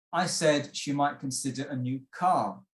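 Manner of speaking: measured sentence with the emphasis on "car"; stress on car. Shown as a demonstration. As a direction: land firmly on the last word, "car". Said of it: "If we emphasize the word car".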